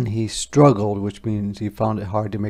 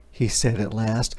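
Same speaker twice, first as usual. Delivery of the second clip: usual speaking voice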